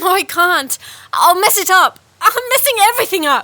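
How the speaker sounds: loud, high and rushing, losing control; panicked outburst. Acted. Princess panics at calling the king.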